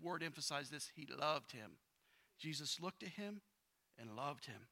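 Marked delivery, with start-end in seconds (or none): 2.4-4.7 soft emphasis. Shown as a demonstration. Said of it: "He, he emphasized".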